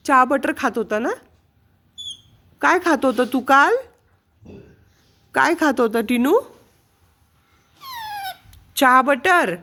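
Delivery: high sing-song coaxing voice for the pet bird; pet talk. Acted. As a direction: coo questions at the bird like to a baby. (पाळीव पक्ष्याशी उंच लाडिक आवाजात बोलणे)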